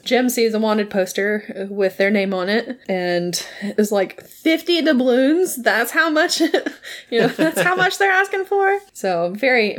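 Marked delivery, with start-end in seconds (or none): 4.2-8.8 high-pitched indignant disbelief; mock outrage. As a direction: imitate being appalled at the price. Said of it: "very mad about it".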